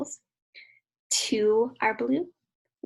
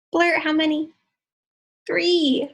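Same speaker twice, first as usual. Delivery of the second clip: loud cue; 5 semitones higher, 9 dB louder than usual. Acted. Teacher cues kids to shout.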